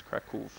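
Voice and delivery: Kraków ending in a v, almost an f; Kraków with oo and a soft final v; Polish pronunciation. Shown as a demonstration. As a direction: say Kraków as Krak-oov. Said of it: "is pronounced like a V sound".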